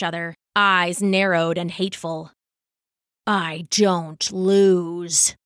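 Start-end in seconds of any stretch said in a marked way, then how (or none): 3.3-5.4 very slow, heavy, menacing words; growling monk. Acted. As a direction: grind each word out like a threat. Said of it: "the monk growled".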